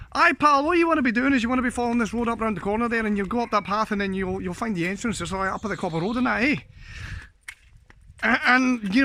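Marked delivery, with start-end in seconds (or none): 0.1-6.6 put-on thick Scottish accent. Scottish lad directing a Japanese tourist, thick fast Scottish accent. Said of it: "you got this Scotsman who basically did this".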